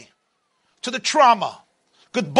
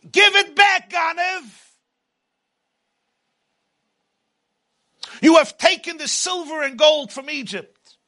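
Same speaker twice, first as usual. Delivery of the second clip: booming motivational speech; 7 semitones higher than usual. Acted. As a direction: call out the thief. People accusing the thief.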